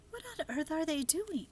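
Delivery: put-on a wide-eyed girl asking; girl's voice. A narrator's reading.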